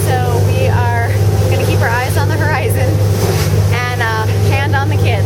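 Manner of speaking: loud, calling over boat noise. Real, not acted. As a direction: talk up over the wind and engine. Talking on a boat in choppy water.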